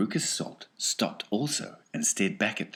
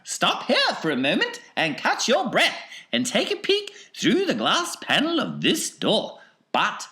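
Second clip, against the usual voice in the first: high, lively showman voice; Wonka's high, bouncy showman voice; eccentric chocolatier; 8 dB louder than usual. Acted. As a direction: play the excitable factory owner, pitched well up. Narrator voicing Mister Wonka.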